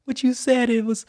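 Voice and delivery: high, drawn-out mock crying voice; tearful imitation. Crying Marine answers the speaker, high mock-tearful wail. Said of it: "What are you crying about".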